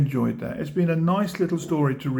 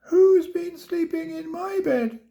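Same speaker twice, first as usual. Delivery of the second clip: much higher squeaky little bear; tiny squeak. A narrator's reading. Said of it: "he squeaked".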